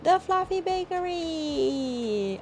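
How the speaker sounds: slow, high, sing-song channel greeting; drawn-out high welcome call; excited intro call. Real, not acted. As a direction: announce the bakery name with a bright lilt. Channel name sung out at start.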